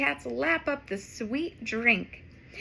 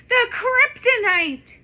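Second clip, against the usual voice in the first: loud, much higher alarmed exclamation; excited shout; 10 semitones higher, 9 dB louder than usual. Acted. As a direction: cry it out in shock. Character exclaiming at glowing rock.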